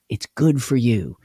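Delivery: ordinary talk, nothing marked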